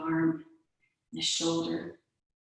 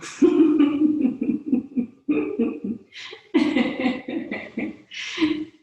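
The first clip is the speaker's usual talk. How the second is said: high loud helpless laughing fit; long loud airy laughter; long real laugh; 8 semitones higher, 6 dB louder than usual. Real, not acted. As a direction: crack up and keep laughing. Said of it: "come into your giggle".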